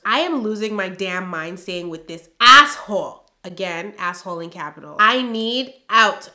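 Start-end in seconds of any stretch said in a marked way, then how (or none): 0.1-3.3 loud. Acted. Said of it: "it's all in capitals". 5.0-6.3 slow, loud plea. Acted. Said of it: "whole entire sentence is in capital letters".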